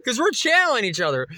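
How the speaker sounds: ordinary talk, nothing marked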